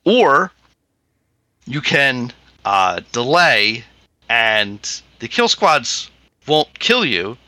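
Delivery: normal talking voice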